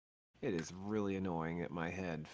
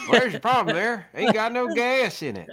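hillbilly voice; 13 semitones higher, 12 dB louder than usual. Pretend. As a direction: yell it like a country mechanic. Acted hick quote, loud laughing.

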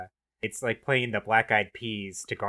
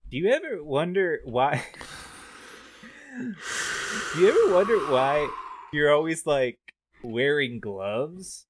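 higher, louder, slow surfer dude; Pauly Shore; 6 semitones higher, 4 dB louder than usual. Pretend. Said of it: "I am playing the roles of ... Pauly Shore".

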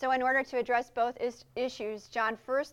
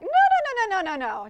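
high, loud rapid no no no; a panicked refusal, played up; alarmed refusal; 9 semitones higher, 9 dB louder than usual. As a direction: shut it down fast, half-laughing alarm. Reacting to demon video request.

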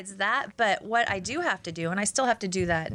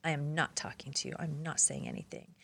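much softer, low curt refusal; cold refusal; 3 semitones lower, 9 dB quieter than usual. Retold or imitated. Grieving daughter refusing to talk to reporter, soft cold refusal. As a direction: shut her out quietly. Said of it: "she's just like this".

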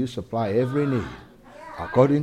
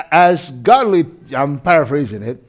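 ordinary delivery

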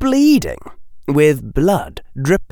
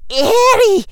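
high, loud, alarmed call; startled shout, pitched way up; shocked cry; 6 dB louder than usual. A narrator's reading. Teacher rushes over in shock.

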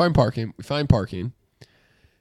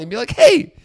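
mock lecture; 8 semitones higher than usual. Imagined lecture to rude stranger.

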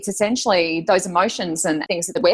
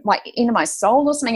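ordinary delivery

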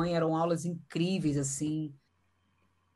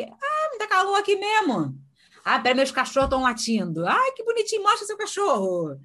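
much higher, delighted chatter about the dogs; gushing small talk; 12 semitones higher, 4 dB louder than usual. Retold or imitated. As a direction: coo over the dogs to build rapport. Friendly interviewer chatting about dogs. (entrevistadora encantada com os cachorros)

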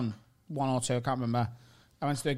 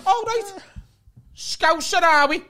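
high, loud imitation of a pub stranger; mock loud greeting; 7 dB louder, slower than usual. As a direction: call out like an over-familiar Scouser. Acted greeting from stranger at the bar.

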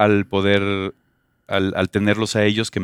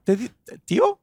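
put-on child's voice; 13 semitones higher than usual. Child's question, retold.

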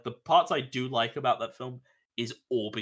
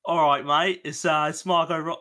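loud, higher-pitched mock Australian; hammed-up Aussie accent, louder than usual; Margot Robbie; 5 semitones higher, 6 dB louder than usual. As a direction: put on a big Australian voice. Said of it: "I know she doesn't sound like that".

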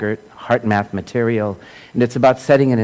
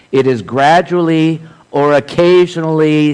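louder, higher, deliberate reading aloud; emphatic quotation reading; 3 semitones higher, 5 dB louder than usual. Acted. Speaker reads a teacher's words to a congregation, emphatic quotation reading. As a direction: read it out with measured, raised emphasis.

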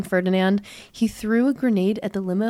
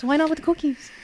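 mock offended archduke; 6 semitones higher, 4 dB quieter than usual. Mocking the archduke's complaint.

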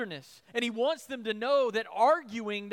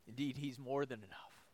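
hushed; 8 semitones lower, 11 dB quieter than usual. Real, not acted. Tender climax of sermon.

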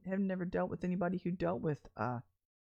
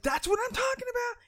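high shouted outburst; exasperated shout; 14 semitones higher, 4 dB louder than usual. Retold or imitated. Missy overwhelmed in the deli, shouting in exasperation. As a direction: yell it up high in frustration. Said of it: "And I'm like".

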